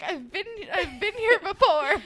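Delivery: laughing. Retold or imitated. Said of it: "I was like".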